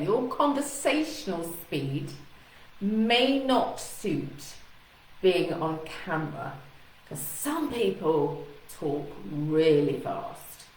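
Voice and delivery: very deliberate, stretched phrases; slowed speech. Shown as a demonstration. Coach repeats the point for viewers, slowly and deliberately. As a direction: take each phrase slowly and separately. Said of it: "I'm really slowing my speech down".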